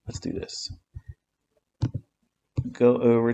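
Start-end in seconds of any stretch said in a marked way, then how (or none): 0.1-0.7 whispered repeat. Real, not acted. Muttering to himself while trading.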